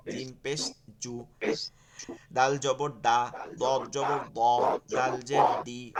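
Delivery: জা জবর জা and দাল জবর দা drawn out slowly, syllable by syllable; slow thin-thick recitation. Shown as a demonstration. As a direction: stretch each syllable to show thin versus thick. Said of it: "চিকন আর মোটা হচ্ছে".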